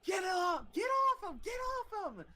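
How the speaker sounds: very high, strained mock scream; retold screaming. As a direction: imitate a friend screaming to stop a fight. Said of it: "like, screaming".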